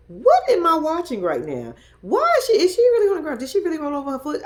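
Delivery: loud, high-pitched disbelief; incredulous outburst. Retold or imitated. Viewer reacting to a woman on the ground, loudly incredulous. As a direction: exclaim it in high, loud astonishment.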